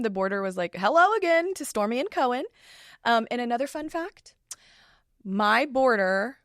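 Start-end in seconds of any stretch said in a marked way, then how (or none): 0.8-2.4 high, louder sing-out hello; imitated greeting. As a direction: greet returning dogs in a lifted voice. Acting out the border's greeting.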